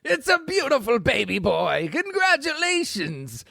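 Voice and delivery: disguised villain congratulating the new mother; falsetto nurse. Acted. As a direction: coo it out like a fake sweet nurse. Disguised nurse announcing baby.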